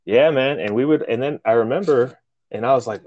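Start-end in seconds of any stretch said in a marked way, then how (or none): none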